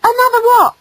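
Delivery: put-on high-pitched baffled boy; puzzled question. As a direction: ask it in startled confusion. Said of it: "he asked".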